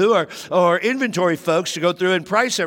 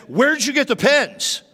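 raised, higher stern question; you've-messed-up voice; 8 semitones higher than usual. Retold or imitated. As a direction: make them know they're caught. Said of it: "in that voice that when you know you've messed up".

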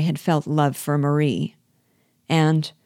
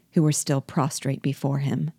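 usual speaking voice

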